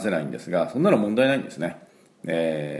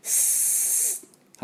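soft unvoiced hiss like a punctured tube; air leak hiss; 8 dB quieter than usual. Shown as a demonstration. Teacher imitating a punctured tyre, hissing. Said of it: "針を穴を開けた、イメージの音です".

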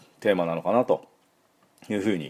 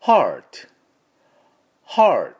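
American "heart" with a firm R, louder; American pronunciation; 4 semitones higher, 6 dB louder than usual. Shown as a demonstration. Teacher contrasting how Americans say heart, American pronunciation. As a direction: curl the tongue for a clear American R. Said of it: "アメリカ人はRをしっかり入れますから".

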